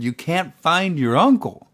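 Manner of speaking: high, loud, mock-incredulous question; puzzled echo question. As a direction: repeat it back to check you heard right. Puzzled, repeating her words back.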